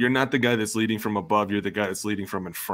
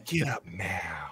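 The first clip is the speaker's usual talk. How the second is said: slow, much softer order; 2 semitones lower, 8 dB quieter, much slower than usual. Retold or imitated. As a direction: say it quietly and slowly, firm. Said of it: "He was".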